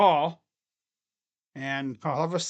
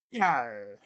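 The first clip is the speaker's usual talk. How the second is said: low pirate growl of a yar; mock pirate interjection, pitched down; horrible pirate; 6 semitones lower, 5 dB quieter than usual. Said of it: "My horrible pirate voice".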